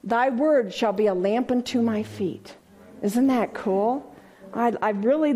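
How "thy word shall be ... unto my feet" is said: ordinary delivery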